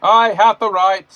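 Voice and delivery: high, loud bossy shout; bossy yelling. A narrator's reading. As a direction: yell orders like a self-important boss. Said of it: "Percy came through the yard yelling".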